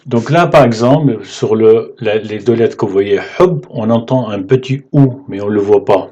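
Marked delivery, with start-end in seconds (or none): none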